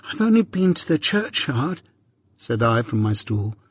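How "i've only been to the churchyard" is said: put-on a touch tearful, higher boy's voice; small boy pleading through tears; crying boy. A narrator's reading. Pip answers his angry sister, lightly tearful. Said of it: "crying and rubbing myself".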